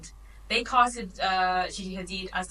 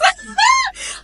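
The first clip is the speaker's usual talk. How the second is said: loud shrieking laugh; 11 dB louder than usual. Real, not acted. Cohost reacting to the Vogue Hairspray shoot, shrieking laugh.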